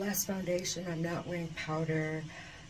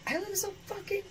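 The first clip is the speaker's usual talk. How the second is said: high, amazed squeal of delight; 13 semitones higher than usual. Real, not acted. Thrilled at her reflection, high. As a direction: gush over your own face.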